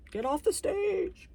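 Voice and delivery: high, slow heckler voice mocking herself. Heckling her own take on the carpet, high and slow. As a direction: jeer at yourself from the crowd.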